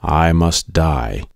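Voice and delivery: plain reading voice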